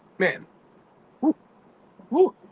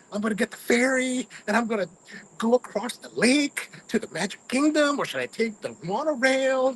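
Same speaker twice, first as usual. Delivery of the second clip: much higher, eager voice; giddy excitement; 8 semitones higher than usual. Retold or imitated. Choosing ferry or monorail, a high giddy gush. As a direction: gush like a kid planning the day. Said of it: "I'm so excited to be here".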